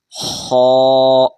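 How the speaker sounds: خو with a rasping throat sound, loud and drawn; throaty kh demo. Shown as a demonstration. Teacher demonstrating the khe sound for pupils. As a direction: scrape خو from the back of the throat. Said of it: "کھا نہیں بولنا".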